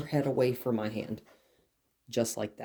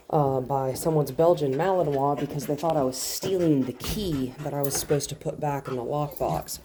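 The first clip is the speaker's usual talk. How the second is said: normal talking voice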